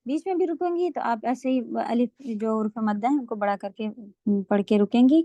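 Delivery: normal talking voice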